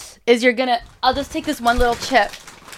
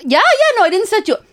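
defensive protest; 12 semitones higher, 7 dB louder than usual. Real, not acted. Denies being set up.

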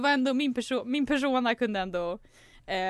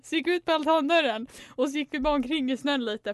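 normal talking voice

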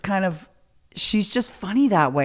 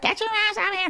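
much higher put-on voice calling him out; Rose calling out; 3 dB quieter, faster than usual. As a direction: call him out of the room in a high voice.

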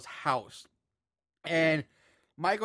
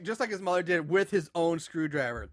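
normal talking voice

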